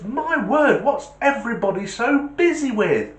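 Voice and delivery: high, loud, exasperated bewilderment; baffled caller. Acted. Caller baffled that everyone is busy, high and loud. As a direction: exclaim it in puzzled disbelief, pitched right up.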